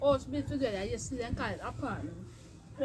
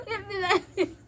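laughing; 7 semitones higher, 3 dB louder than usual. Real, not acted. Laughing at her own question.

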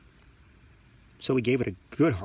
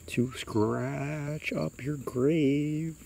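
high, very slow, eerie drawl; creature's drawn-out threat; spooky creature; 5 semitones higher, much slower than usual. A narrator's reading. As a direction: drag the words out hauntingly. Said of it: "the creature answers".

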